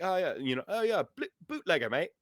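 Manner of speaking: bad British accent that slides into Australian; botched British-Australian sailor accent; mock British accent. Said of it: "then the British went like".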